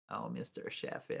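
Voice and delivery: Fran Drescher. Retold or imitated. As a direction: breathe out a Queens-nanny call to her boss. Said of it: "Fran Drescher".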